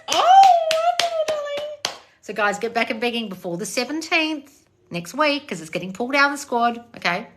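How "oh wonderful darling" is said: squeals wonderful, way up high; delighted high praise; gushing reaction to a viewer's win. Real, not acted. Reacting to good news.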